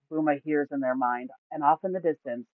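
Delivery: ordinary delivery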